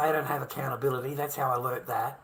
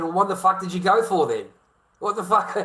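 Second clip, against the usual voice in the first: raised, higher voice, demanding answers; retold angry outburst; 5 semitones higher, 6 dB louder than usual. Husband confronting wife who left for days, angry demand. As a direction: snap the question back at her. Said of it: "So I said".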